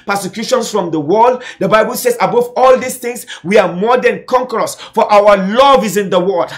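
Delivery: impassioned sermon delivery; preaching. Real, not acted. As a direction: declare it in forceful, rhythmic phrases to the congregation. Preaches at full force.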